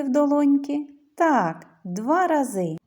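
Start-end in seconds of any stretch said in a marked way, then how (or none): none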